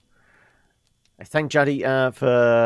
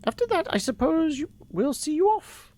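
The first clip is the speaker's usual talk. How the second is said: put-on high, airy old woman voice; a fond grandmother reminiscing; old lady voice; 13 semitones higher than usual. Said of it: "So we should do the old lady voice".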